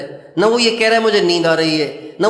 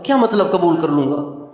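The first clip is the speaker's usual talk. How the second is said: usual speaking voice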